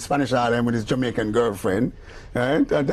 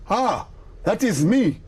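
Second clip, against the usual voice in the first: amazed recognition; 6 semitones higher, slower than usual. Retold or imitated. Friend hearing himself recorded.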